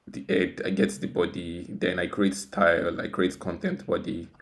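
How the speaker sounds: normal talking voice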